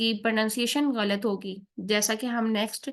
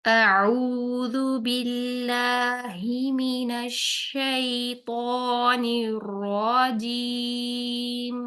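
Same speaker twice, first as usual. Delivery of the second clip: slow, drawn-out tajweed chant; slow Quranic recitation; much slower than usual. Shown as a demonstration. Tajweed teacher demonstrating the full taawwudh slowly. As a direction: recite each syllable long and measured. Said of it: "ہم تعوذ اور تسمیہ کو دیکھیں گے".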